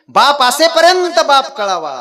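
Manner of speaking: high, loud preacher's cry; forceful exhortation. Real, not acted. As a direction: proclaim it at full force to the congregation.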